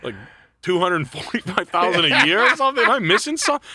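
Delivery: mock outrage at the store's pay; retold disbelief. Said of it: "I was like".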